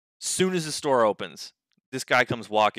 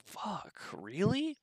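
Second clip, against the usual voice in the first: rising disbelief, pitch jumping up; quietly stunned what the fuck, rising; incredulous; 6 semitones higher, 8 dB quieter, slower than usual. Retold or imitated. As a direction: say it like you can't believe it. Own disbelief quoted.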